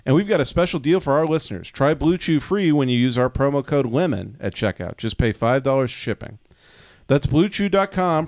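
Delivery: upbeat sponsor read. Acted. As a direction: sell it like a radio ad. Podcast host reads sponsor ad.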